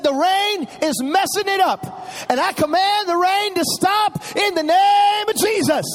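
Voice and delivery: put-on small boy rebuking the rain, full force; commanding child prayer. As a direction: order the rain to stop like a little prophet. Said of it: "He said".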